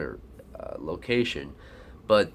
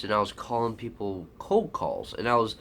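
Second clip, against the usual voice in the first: ordinary delivery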